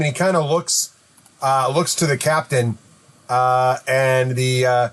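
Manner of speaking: usual speaking voice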